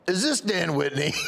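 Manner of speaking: mock incredulous question; suspicious radio host. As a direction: challenge the caller, lightly mimicking the host. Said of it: "He goes".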